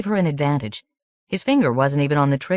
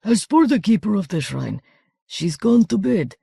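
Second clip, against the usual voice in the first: hoarse soldier's voice; 6 semitones higher than usual. Acted. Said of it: "her hoarse soldier's voice".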